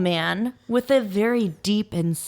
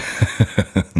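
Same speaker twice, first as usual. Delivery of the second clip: breathy laugh; 14 semitones lower than usual. Real, not acted. Unvoiced laugh.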